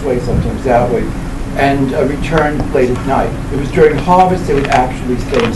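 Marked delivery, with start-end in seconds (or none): none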